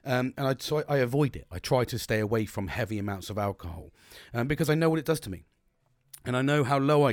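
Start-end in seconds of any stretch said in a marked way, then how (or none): none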